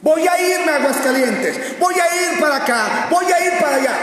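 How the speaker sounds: high, loud mock boasting; hammed-up, insistent bragging; boastful. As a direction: crow it like a cocky braggart. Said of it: "El jactancioso cree que puede ir".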